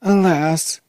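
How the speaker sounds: high-pitched lament of a princess; tearful princess. Acted. Princess lamenting to the dwarf, woeful. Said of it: "no wonder that I am crying".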